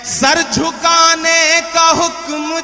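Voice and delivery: loud, sung Urdu verse recital; stately nazm recitation. Acted. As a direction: chant each verse slowly with swelling force.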